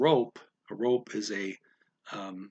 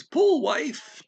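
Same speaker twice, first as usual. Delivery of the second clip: calling out; 7 dB louder than usual. Retold or imitated. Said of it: "It says".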